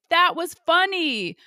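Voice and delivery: high, slow, dripping sarcasm; exaggerated high sarcasm; sarcastic mock amusement. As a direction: play along with heavy irony. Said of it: "was like".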